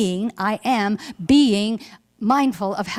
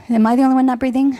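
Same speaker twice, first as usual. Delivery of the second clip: quick, higher, louder teasing question; playful nudge; 4 semitones higher, 4 dB louder, much faster than usual. Real, not acted. Minister teasing the congregation about breathing. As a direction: tease the room into breathing. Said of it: "You guys aren't breathing".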